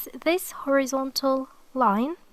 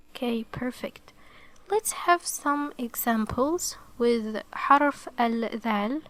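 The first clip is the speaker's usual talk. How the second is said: ordinary talk, nothing marked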